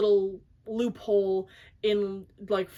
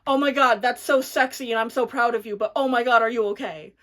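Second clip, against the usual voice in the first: worried gush; 3 semitones higher, 7 dB louder than usual. Retold or imitated. Boyfriend shocked at her injury, loud concerned gush. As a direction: gasp out boyfriend's worry at her. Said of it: "He's like".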